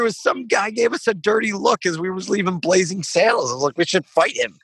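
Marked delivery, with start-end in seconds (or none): 3.8-4.6 higher, dopey tipsy challenge; drunk bravado. Retold or imitated. Goofy drunk eagerness. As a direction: drunk leaving the bar, higher dopey proposal to fight.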